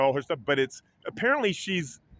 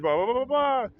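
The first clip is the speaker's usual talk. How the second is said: dismissive blah blah; 8 semitones higher than usual. Retold or imitated. Dismissive summary of staff talk.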